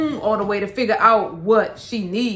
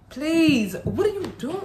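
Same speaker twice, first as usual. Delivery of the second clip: high, startled what-are-you-doing; alarmed question; 6 semitones higher, slower than usual. Real, not acted. Mother startled by her toddler's mischief, high and alarmed. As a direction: react with alarm.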